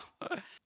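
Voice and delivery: light chuckle; short amused chuckle. Acted. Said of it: "she kinda chuckles".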